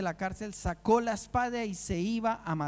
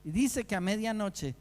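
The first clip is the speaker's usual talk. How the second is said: ordinary talk, nothing marked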